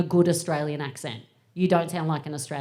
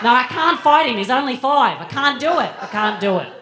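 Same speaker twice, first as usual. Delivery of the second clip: loud, high broad Australian protest; broad Aussie convict; 5 semitones higher, 9 dB louder than usual. Retold or imitated. Australian mum resisting her posh son, broad Aussie holler. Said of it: "brings out the convict in me".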